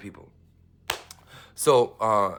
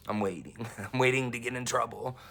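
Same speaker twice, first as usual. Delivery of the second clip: giggling through the words; real laughter bubbling under the line; laughing; 3 semitones higher, 3 dB quieter than usual. Real, not acted. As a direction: laugh it out, half nervous. Laughs while waiting for trouble.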